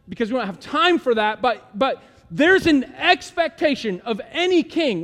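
loud, emphatic sermon voice; preaching at full force. Acted. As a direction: proclaim it to the congregation.

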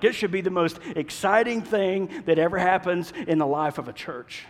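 ordinary delivery

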